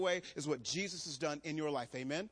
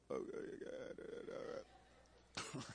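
awkward whisper; 3 semitones lower, 12 dB quieter, much slower than usual. Retold or imitated. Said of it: "I'm like".

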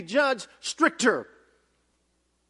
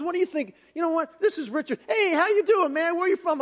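higher, chatty voice of a welcoming president; a lightly raised, friendly imitation of the president; 7 semitones higher than usual. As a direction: play the warm leader greeting a stranger. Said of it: "He goes".

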